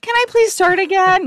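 very high, loud pleading squeak; squeaky nervous plea. Retold or imitated. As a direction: beg for a retry in a high squeak. Nervous audition plea retold.

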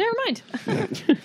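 high, slow, drawn-out sing-song; playful sing-song. Pretend. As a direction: stretch it out, teasing. Repeats it playfully.

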